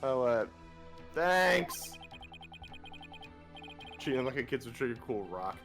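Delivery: normal talking voice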